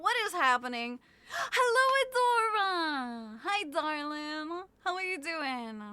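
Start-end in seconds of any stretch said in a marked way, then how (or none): 1.3-5.8 excited greeting. Real, not acted. Greeting viewer with a gasp.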